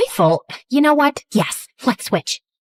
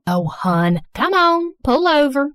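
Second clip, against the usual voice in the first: put-on a light, fussy Southern mom accent; a hint of a Southern mother fretting over her daughter; thick Southern accent; 5 semitones higher than usual. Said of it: "speaking in a thick Southern accent".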